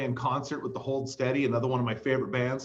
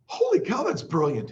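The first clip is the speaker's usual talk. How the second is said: high excited exclamation; amazed; 5 semitones higher than usual. Real, not acted. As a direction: burst out in amazement.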